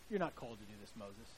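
muted mocking accuser; low doubting voice. Imagined accusers doubting Moses.

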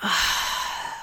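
long unvoiced exhale sigh. Shown as a demonstration. Podcast host to listeners, demonstrating a slow audible sigh. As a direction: let the breath go in a slow sigh. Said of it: "and sigh it out".

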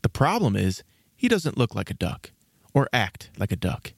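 ordinary delivery

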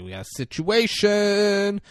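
high, slow, drawn-out alert; mock radio alert. Barry radios Chris Pratt, mock alarmed call. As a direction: stretch it out high, announcing trouble. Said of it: "he's trying to radio Chris Pratt".

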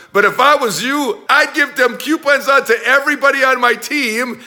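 loud, high hard-sell ad read; energetic sales pitch. Acted. Coach pitching his team on training videos, loud and eager. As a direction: push the product with big energy.

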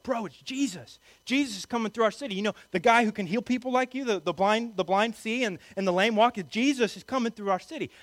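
high, eager crowd voice; excited fangirling. Acted. Crowd telling the blind beggar who is coming, excited fangirling. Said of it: "some first century fangirling going on".

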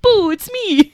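high, loud mock "boo" of a prankster king; playful surprise. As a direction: jump out at her, hammed-up. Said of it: "be like".

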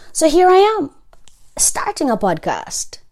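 high, loud, triumphant burst; excited announcement. Real, not acted. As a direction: announce it like a big reveal.

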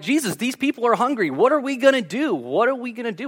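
high, anxious pleading; mock panicked disciples. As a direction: fret like worried disciples. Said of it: "The disciples were like".

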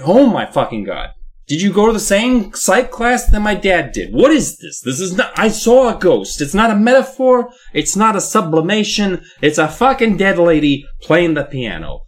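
put-on a kid's fed-up rant at the shrink; mock exasperated rant. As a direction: vent at the clueless doctor. Exasperated kid rant, louder.

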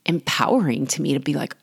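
normal talking voice

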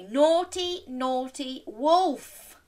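slow, high telling-off; playful scolding. Pretend. Storyteller leading children in scolding wolf, slow mock telling-off. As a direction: scold word by word.

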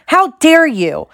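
high, loud, drawn-out indignation; stretched, high-pitched cry of outrage; outraged shriek. A narrator's reading. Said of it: "Alicia shrieked".

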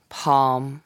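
normal talking voice

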